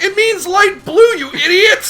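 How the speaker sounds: high, loud mock outburst; exasperated yell. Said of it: "I'm like".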